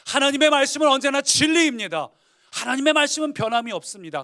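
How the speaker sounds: the preacher proclaiming God's word to the congregation; ringing proclamation. Real, not acted. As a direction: preach it out at full force. Preacher restating the truth louder.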